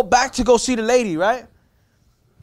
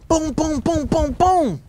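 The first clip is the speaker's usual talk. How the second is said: imitated knocking; 8 semitones higher, much slower than usual. Dad banging on the truck window, mimicked knocks. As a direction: boom boom knocks said higher.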